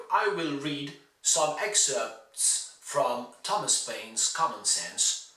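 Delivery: ordinary talk, nothing marked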